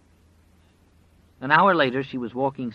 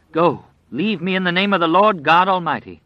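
loud, high, pleading command; desperate shout; 8 semitones higher, 9 dB louder than usual. Acted. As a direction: cast the beast out. Terrified woman banishing the wolf.

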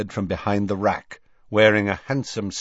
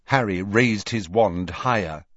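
ordinary delivery, nothing marked

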